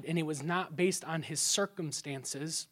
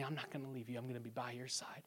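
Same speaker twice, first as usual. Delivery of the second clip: much softer, faster, near a whisper; hushed promise; 5 semitones lower, 17 dB quieter, faster than usual. Retold or imitated. Wife promising to stay, hushed and tender. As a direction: murmur it close and tender.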